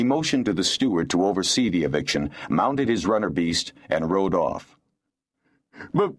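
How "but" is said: high, sniveling protest; whiny, self-pitying plea; piteous snivel. A narrator's reading. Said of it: "sniveling and twisting his face into a piteous expression".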